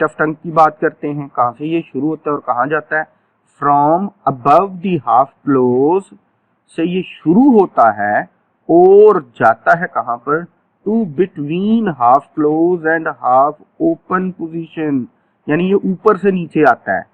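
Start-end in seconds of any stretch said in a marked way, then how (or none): 3.6-6.0 very slow, each word spaced out; stretched-out classroom dictation; slow drill phrase. Shown as a demonstration. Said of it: "teacher drills the phrase". 10.9-15.0 very slow, each word spaced out; stretched-out classroom dictation; slow drill phrase. Shown as a demonstration. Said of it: "teacher drills the phrase".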